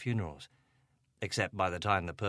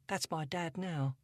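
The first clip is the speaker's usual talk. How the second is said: put-on higher, upset young girl voice; Angela, a hint of upset teen; teenage girl; 8 semitones higher, 4 dB quieter than usual. A narrator's reading. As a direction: voice her as a troubled teenage girl.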